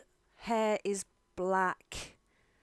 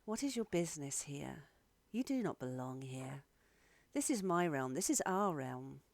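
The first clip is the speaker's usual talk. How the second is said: soft, low, eerie challenge; hushed, forbidding many-voiced woman; unnerving Calantha; 4 semitones lower, 5 dB quieter than usual. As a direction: quietly warn intruders off as an otherworldly being. Game master voices Calantha.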